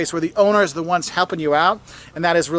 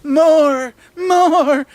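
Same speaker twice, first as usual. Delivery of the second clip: higher, louder voice of a greedy tummy begging for more; 9 semitones higher, 6 dB louder than usual. Pretend. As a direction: play your stomach begging for more chowder. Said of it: "my tummy is just like".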